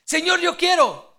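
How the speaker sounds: loud, high volunteering cry; eager plea. Acted. Peter volunteering to Jesus in the boat, eager cry. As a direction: burst out with keen wanting.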